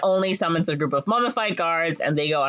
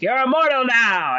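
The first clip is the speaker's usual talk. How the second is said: hammed-up high hero's cry; mock-heroic declaration; triumphant taunt; 7 semitones higher, 4 dB louder than usual. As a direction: crow it at the villain.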